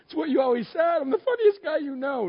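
high-pitched, hammed-up indignation; wounded protest. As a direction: plead your case in comic disbelief. Acts out wounded protest to wife.

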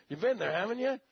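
ordinary talk

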